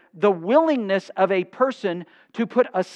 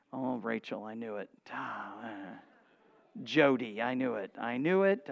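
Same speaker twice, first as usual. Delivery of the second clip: very low, hushed mock dismay; soft, sunken voice of pretend disappointment; 9 semitones lower, 12 dB quieter than usual. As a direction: mutter it as a teasing aside. Teasing listeners who raised their hands.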